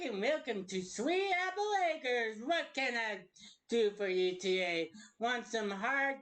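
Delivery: normal talking voice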